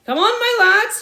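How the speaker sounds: high, loud rallying call; rally the lads cheerfully; cried out. A narrator's reading. Sergeant rallying the men, loud call. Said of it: "he cried".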